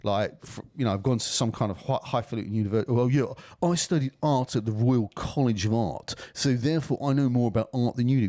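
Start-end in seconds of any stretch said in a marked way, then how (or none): 2.9-8.3 pretentious art student. Acted. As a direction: draw it out like a self-important art student. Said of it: "these pretentious gobshites".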